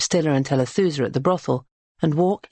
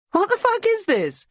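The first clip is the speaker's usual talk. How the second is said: high, startled demand; shocked outburst. A narrator's reading. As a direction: customer caught off guard. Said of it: "nervous laugh".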